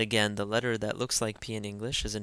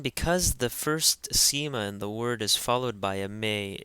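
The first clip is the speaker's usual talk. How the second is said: ordinary talk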